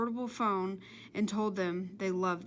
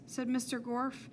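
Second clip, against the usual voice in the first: plain reading voice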